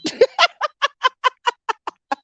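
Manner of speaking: long, high giggling laugh; amused laughter. Real, not acted. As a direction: crack up at your own impression. Laughs at sister's reply.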